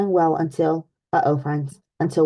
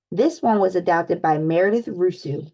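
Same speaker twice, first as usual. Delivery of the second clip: ordinary delivery, nothing marked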